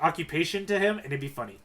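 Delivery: ordinary delivery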